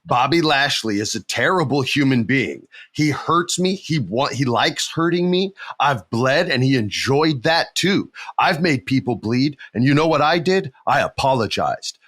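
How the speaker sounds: usual speaking voice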